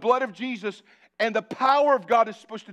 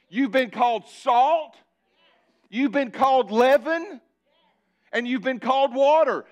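steady preacher's rhythm on each called; preaching cadence; 3 semitones higher than usual. Acted. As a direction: build it line by line like a sermon refrain. Preaching litany to congregation.

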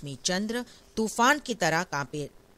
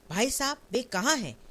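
crying out; 6 semitones higher, 4 dB louder than usual. Acted. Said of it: "Uma चीख कर".

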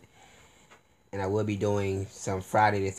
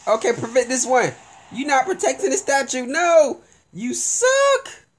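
angry yelling; 8 dB louder than usual. Real, not acted. Yelling at teammates.

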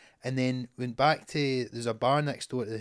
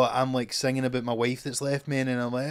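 ordinary delivery

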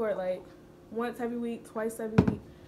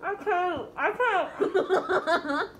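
high, breaking into laughter; can't stop laughing; 7 semitones higher, much slower than usual. Real, not acted. Cracking up mid challenge.

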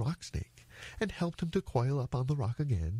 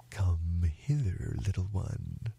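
slow, low crocodile voice; luring crocodile; 3 semitones lower, slower than usual. Acted. Crocodile lures the little elephant closer, low and slow. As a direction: draw the words out low to lure.

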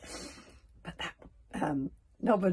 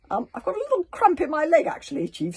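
excuse; 10 semitones higher, 9 dB louder than usual. Acted. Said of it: "said Nobber".

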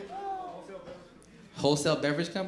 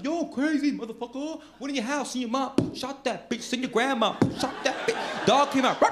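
higher-voiced mock rapper boasting; fake gangsta rapper; 6 semitones higher than usual. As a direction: ham up a tough-talking rapper. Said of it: "Lie their asses off".

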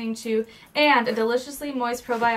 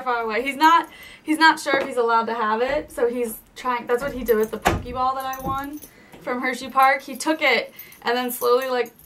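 ordinary delivery

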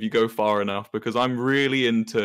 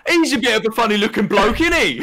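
high, loud mock British geezer; hammed-up British accent; 9 semitones higher, 5 dB louder than usual. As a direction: ham up the British accent loudly. Hammed-up British character phrase.

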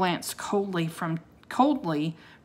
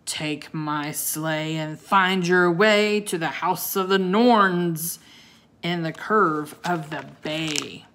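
growling voice; 6 dB louder than usual. Acted. Wizard growls his command at Jack and Annie. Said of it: "In a growling voice".

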